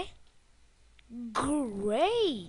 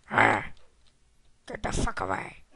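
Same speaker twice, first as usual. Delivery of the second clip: ordinary talk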